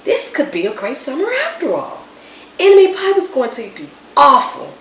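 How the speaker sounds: plain reading voice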